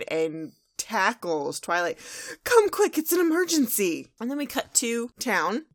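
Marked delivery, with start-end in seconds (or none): 2.4-4.1 high, loud urgent call; Rainbow Dash. Acted. Pony swooping in on Twilight, urgent high shout. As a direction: burst in shouting, pitched way up. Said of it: "Rainbow Dash, like, swoops in".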